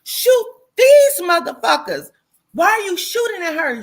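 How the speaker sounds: high, loud, half-laughing shout; retold yell. Shouting at the woman shooting the wrong people, loud and high. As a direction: yell at her in disbelief.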